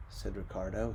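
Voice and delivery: ordinary delivery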